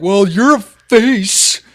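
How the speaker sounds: high, loud, very slow fumbled comeback; lame comeback. Retold or imitated. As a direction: drag out a lame insult, way too loud. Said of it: "I'll probably be like".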